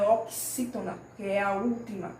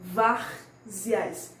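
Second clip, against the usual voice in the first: "várzeas" split, heavy stress on VÁR; stressed-syllable demo; 2 semitones lower than usual. Shown as a demonstration. Teacher shows pupils the tonic syllable, stress on VÁR. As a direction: punch VÁR, pause, then let "zeas" follow. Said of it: "a sílaba tônica da palavra".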